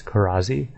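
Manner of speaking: "Karazi" with a buzzing Z; correct way. Shown as a demonstration. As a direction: say "Karazi" with a voiced Z in the middle. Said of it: "the S is pronounced as a Z".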